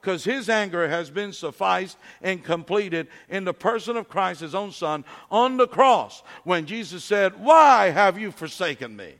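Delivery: loud, climbing preacher's cry; rising to a shout over the cross; preaching at full force. Acted. Pastor preaching the cross to the congregation, loud and building.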